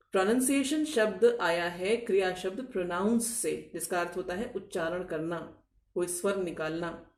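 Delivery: normal talking voice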